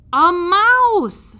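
high, loud "a mouse"; insistent. Acted. Princess insisting on the mouse, firm. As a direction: stand firm, she knows she's right. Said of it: "said the P, who knew that she was right".

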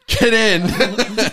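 high, loud mock command with a laugh; hammed-up order. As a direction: call it out like a comic order. Voices order to misfit toys.

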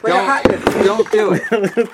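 lightly done boxer lisp; Mike Tyson impression. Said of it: "now we're doing the Mike Tyson impressions".

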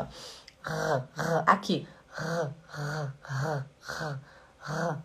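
a quiet throaty R with no tongue movement; soft uvular R; soft German R. Shown as a demonstration. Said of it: "a língua não mexe".

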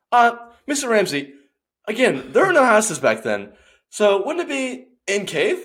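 a nervous cave dweller correcting the chef; squeaky pedantic caveman; mock timid caveman. Mock caveman questioning Ramsay.

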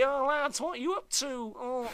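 high-pitched mock Scouse; Liverpool accent. Punk lads challenge their followers, in high mock Scouse. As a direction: put on Liverpool, pitched well up. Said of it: "that's me being Liverpool again".

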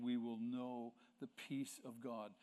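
ordinary talk, nothing marked

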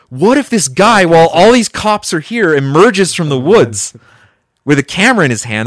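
high, loud imagined alarm; animated mock horror; retold terror. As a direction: re-enact dreading the worst, slowing on the reveal. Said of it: "my relief turned to terror".

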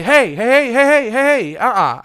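mock panic to make him stop; alarmed protest. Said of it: "So I had to say".

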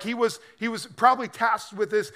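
ordinary talk, nothing marked

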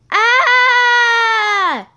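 loud squeal. Retold or imitated. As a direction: shriek it out high and loud. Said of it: "I heard a loud squeal".

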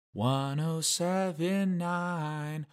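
slow silky radio DJ; smooth jazz DJ voice. Retold or imitated. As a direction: croon the station name slowly.

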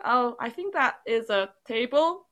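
ordinary talk

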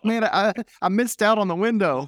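high, loud mock whine; hammed-up regret; mock disappointed fan. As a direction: play the sulking fan who missed out.

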